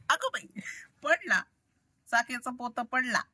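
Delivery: high, quick "अगं बाई"; startled exclamation. Real, not acted. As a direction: caught off guard as it drops. Thing falls, startled. (अचानक काही पडल्यावर उंच आवाजात दचकणं)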